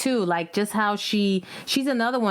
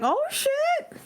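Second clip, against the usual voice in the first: high-pitched stunned exclamation; shocked. Retold or imitated. Guest reliving her surprise at the list, shocked. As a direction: re-enact the jolt, voice leaping up. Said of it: "I was shocked".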